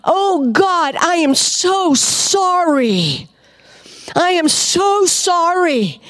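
high, slow, tearful pleading; reliving a sobbing apology; retold crying prayer. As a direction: cry it out as a sorry plea. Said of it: "tear, and crying".

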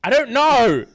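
high, nervous non-answer; awkward laughing shrug. Pretend. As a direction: squirm out of it. Acts awkward breakup reply.

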